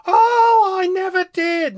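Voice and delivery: very high, loud outraged denial; indignant protest. A narrator's reading. Second alien denying the accusation, indignant protest. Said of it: "to emphasize his protest".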